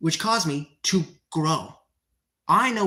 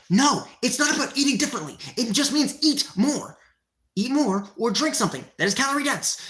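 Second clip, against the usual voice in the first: raised, fast angry rant at chat; heated rant; 5 semitones higher than usual. Real, not acted. Streamer ranting at a chat commenter, angry and raised. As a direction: snap back hard and fast.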